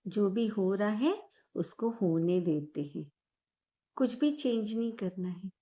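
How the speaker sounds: calm guided breathing meditation voice. Acted. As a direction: ease the listener into calm, gently. Leads guided meditation after intro.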